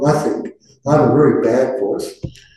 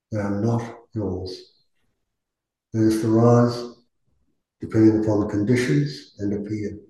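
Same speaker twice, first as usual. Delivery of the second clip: guided meditation. Acted. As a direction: ease the group gently into stillness.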